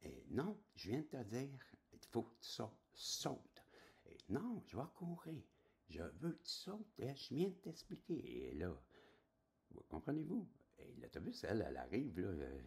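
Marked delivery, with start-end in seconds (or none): 0.3-3.6 high, insistent scolding; the voice losing patience, much higher; mock exasperated order; inner voice angry. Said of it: "il se fâche". 4.3-8.5 high insistent scolding; mock annoyed command; exasperated inner voice. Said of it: "il se fâche".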